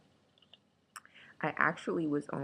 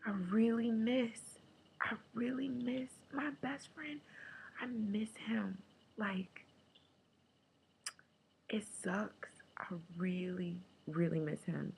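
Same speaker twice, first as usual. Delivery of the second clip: whispering through tears, breaking; a quiet, hushed ache of missing someone; soft tearful whisper to herself; 6 semitones higher, 4 dB quieter than usual. Retold or imitated. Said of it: "I'm just like".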